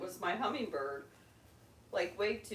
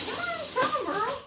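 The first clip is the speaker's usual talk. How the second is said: much higher coaxing; high pet voice; 3 dB louder, faster than usual. Real, not acted. Urging the pet.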